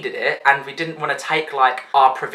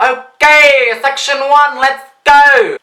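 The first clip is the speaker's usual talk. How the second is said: much higher and louder, megaphone style; megaphone call; 10 semitones higher, 9 dB louder, much slower than usual. Retold or imitated. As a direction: bellow like security on a megaphone. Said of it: "with their freaking megaphones".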